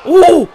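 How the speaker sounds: high, loud ooh; startled ooh. Real, not acted. Gamer reacting to a blow, high startled ooh. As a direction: hoot sharply at the blow. Hit in the fight.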